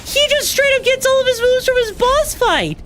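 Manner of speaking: high, disbelieving yell; shocked shout. Real, not acted. Shocked at boss moves.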